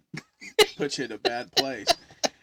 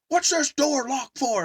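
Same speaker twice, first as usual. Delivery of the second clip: loud, high, drawn-out demand; indignant retold shout; acted angry demand; 12 semitones higher, 9 dB louder, slower than usual. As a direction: play an irate boyfriend at the door. Boyfriend at locked door, acted.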